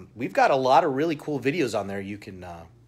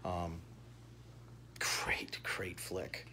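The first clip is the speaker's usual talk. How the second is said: soft whisper, much quieter, lower; whispered praise; 7 semitones lower, 16 dB quieter, much slower than usual. Real, not acted. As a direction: whisper it like a secret compliment. Aside praising the film.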